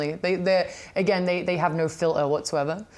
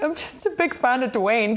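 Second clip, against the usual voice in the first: tearful excuse; 6 semitones higher than usual. Retold or imitated. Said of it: "she sees I was crying".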